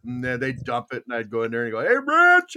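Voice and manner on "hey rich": loud, high call for his son; retold yell. Dad yelling to his son about a wood delivery, calling out. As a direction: call out to summon him, raised and high. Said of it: "I'd yell to him".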